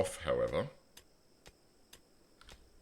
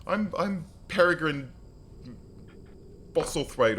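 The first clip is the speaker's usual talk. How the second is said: higher, halting newcomer introducing himself; hesitant newcomer; 10 semitones higher than usual. Retold or imitated. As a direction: stammer out a fancy name nervously. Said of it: "And he's like".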